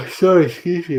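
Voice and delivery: apology stretched by a real yawn. Real, not acted. Streamer apologizing to chat mid-yawn, yawning. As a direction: talk through a yawn. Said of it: "I'm just yawning".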